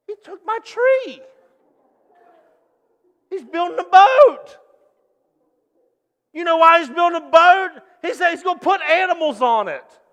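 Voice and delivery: loud, high, mocking neighbor voice; a neighbor scoffs at the boat, loud and high; gossiping neighbor. Neighbor mocks Noah to the crowd, loud mocking gossip.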